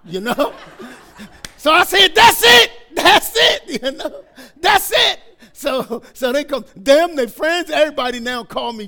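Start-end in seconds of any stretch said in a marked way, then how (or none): 2.1-3.8 high, very loud cry of approval; excited shout. Retold or imitated. Grandpa seizing on the grandkid's answer, excited shout. Retold delight at new nickname. 4.6-5.2 high, very loud cry of approval; excited shout. Retold or imitated. Grandpa seizing on the grandkid's answer, excited shout. Retold delight at new nickname.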